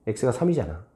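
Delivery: ordinary talk, nothing marked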